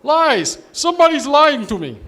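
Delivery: hammed-up furious South African yelling accusation; South African shout. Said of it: "the South African guy goes".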